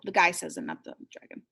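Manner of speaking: plain reading voice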